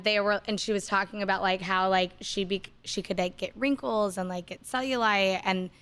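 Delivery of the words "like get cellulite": ordinary delivery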